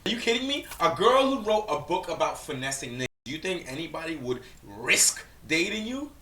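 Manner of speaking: high, loud disbelieving rant; loud scoffing at the clip; incredulous outburst. Real, not acted. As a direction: blast her in disbelief.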